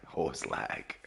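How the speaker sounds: breathy whispered aside. Real, not acted. Muttering the game lag to himself in a whisper. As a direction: drop to a whisper.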